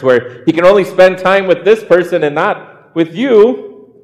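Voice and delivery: usual speaking voice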